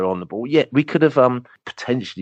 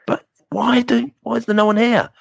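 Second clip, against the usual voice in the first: mock disbelief. Asking the German fans where everyone is, in high mock disbelief. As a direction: pitch it way up in amazed puzzlement.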